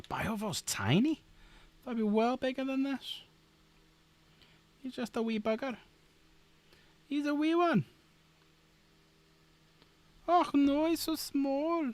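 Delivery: high playful Scottish voice over tiny models; fussing over a wee figure in Scottish; Scottish accent. Pretend. Streamer cooing at a tiny model in a Scottish accent. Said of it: "Don't know why I'm doing it Scottish".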